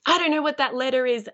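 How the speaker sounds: put-on high, whiny child's complaint; frustrated child. Child who doesn't know a letter, frustrated whining. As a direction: imitate a kid giving up. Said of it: "dealing with the frustrations".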